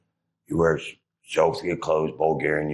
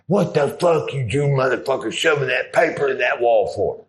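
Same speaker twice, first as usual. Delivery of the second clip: mock heckler; 7 semitones higher than usual. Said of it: "acted taunt at Wailing Wall".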